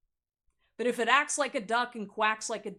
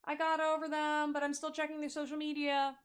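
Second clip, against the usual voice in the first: higher, whiny fake-over-it voice; mock a pining ex, pitched up; 6 semitones higher than usual. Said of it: "Not the fake".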